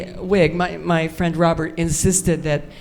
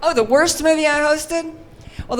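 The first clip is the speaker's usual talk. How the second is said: ordinary delivery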